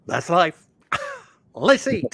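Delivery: high, chirpy throwaway line; cheery mock eulogy. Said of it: "you just go and go".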